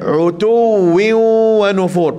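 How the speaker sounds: slow Arabic recital of Utuwi wa nufur; Quran recitation. Shown as a demonstration. Teacher recites the verse for the class. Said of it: "Pastikan ain makhraj dia".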